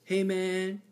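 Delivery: a hint of a beggar's opening pitch; imitated beggar. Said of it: "he goes".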